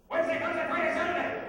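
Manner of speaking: high, loud rattled-off day names; shouted repeat. Acted. Grumplebuffin answers the little people's "What?", shouting. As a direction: belt the days out impatiently.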